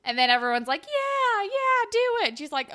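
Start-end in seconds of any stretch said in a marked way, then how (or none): 0.8-2.3 loud, high imitation of an egging-on crowd; crowd cheering on. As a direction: mimic everyone cheering "go on". Crowd urging her on.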